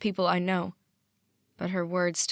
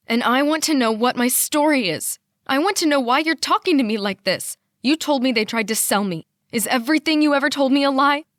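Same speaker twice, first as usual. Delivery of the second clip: higher and louder, pushing into a yell; angry outburst; 6 semitones higher, 7 dB louder than usual. A narrator's reading. Daughter confronting her father on the phone, shouting. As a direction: demand answers, voice rising. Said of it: "This outburst has taken a lot out of me".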